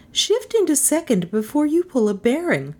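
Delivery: high-pitched bossy passenger; bossy Melissa. Acted. Passenger instructing the driver, high and bossy. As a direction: order the driver about in a high voice.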